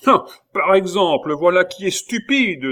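scornful outburst. A narrator's reading. Abbé scoffing at the pupil's argument. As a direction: scoff at the idea. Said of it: "dit l'abbé".

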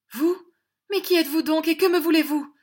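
high, loud frightened cry; startled fright. A narrator's reading. Angèle recoiling from the intruder, frightened cry. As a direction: recoil in alarm as you ask. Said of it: "en se reculant avec effroi".

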